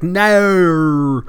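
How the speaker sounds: "No" drawn out loud and high as "naur"; Australian NO. Shown as a demonstration. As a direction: stretch "No" into a long Aussie "naur". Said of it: "They just say things like".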